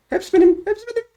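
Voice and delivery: very high, squeaky gloating; gleeful squeal. Pretend. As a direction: claim it all in a tiny high squeak. Gleefully claiming all the loot.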